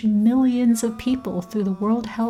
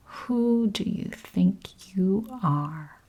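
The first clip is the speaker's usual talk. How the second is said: slow, hushed, mocking inner voice; 6 dB quieter, much slower than usual. A narrator's reading. Inner policeman taunting a new entrepreneur at the bank doors, mocking. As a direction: taunt under the breath, drawn out and soft. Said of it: "mocking me at the bank doors".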